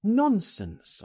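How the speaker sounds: high, emphatic brush-off; dismissive cry. A narrator's reading. Narrator dismisses his friend's fear of police with a cry. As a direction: cry it out, pitched well up. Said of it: "I cried".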